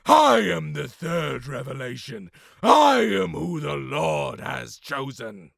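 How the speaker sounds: loud, high, drawn-out proclamation; acted shouting. Acting test, proclaiming himself chosen to Eli, shouting. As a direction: roar it like a mad prophet. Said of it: "there's a lot of shouting".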